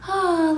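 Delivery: high, drawn-out moaning oh; hammed-up moan; mock moan. Shown as a demonstration. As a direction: moan it high like you're feeling it. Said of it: "a little moan, like".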